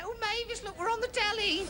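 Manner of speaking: a high-pitched, hushed imitation of an excited lady. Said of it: "there's a lady saying".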